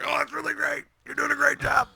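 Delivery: high, loud mock praise; pained sarcasm. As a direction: shout hammed-up praise through the pain. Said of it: "you just hear me, I'm like".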